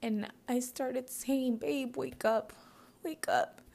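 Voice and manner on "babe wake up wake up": 1 pleading. Retold or imitated. Retold pleading with husband.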